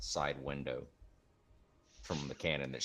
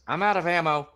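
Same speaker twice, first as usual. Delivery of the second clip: high, loud, urgent gnome voice; Tina's voice; 12 semitones higher, 13 dB louder than usual. Acted. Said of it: "She goes".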